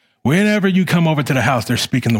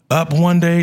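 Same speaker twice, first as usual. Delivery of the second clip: normal talking voice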